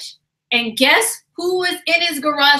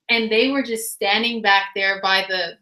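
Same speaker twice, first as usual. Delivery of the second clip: usual speaking voice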